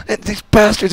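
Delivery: high, loud mock outrage; Wild Bill cursing. Wild Bill ranting to his boss, high loud mock outrage. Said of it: "he was just freaking out and cursing".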